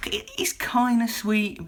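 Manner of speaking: "it is kind of sweet" whispered, slow and breathy; whispered aside. Real, not acted. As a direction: hush it like a fond secret. Whispers the repeated line.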